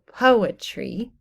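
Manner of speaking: "poetry" slowly, PO stressed and the last two syllables lighter; stressed, unstressed, unstressed. Shown as a demonstration. Teacher illustrates a dactyl, first-syllable stress. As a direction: draw out "poetry" as PO-e-try. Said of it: "and it goes stressed, unstressed, unstressed".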